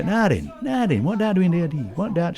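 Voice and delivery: put-on Sheffield accent. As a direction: imitate a Sheffield local, pitch up. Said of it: "'cause I love accents".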